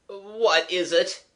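high, slow, bewildered gasp; feeble gasp. Acted. Shaken young man asking the children, feeble gasp. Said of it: "he gasped feebly".

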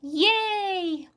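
high, loud drawn-out cheer; ringing high hooray; joyful shout. A narrator's reading. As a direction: shout it out in celebration. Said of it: "Annie shouted".

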